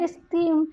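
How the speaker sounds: esteem as es-TEEM; second-syllable stress. Shown as a demonstration. Teacher giving a bisyllabic example, esteem stressed on its second syllable. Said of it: "receive the primary stress on the second".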